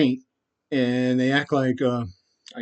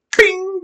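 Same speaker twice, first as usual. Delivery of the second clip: mock ping sound; 4 dB louder than usual. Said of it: "sound effect".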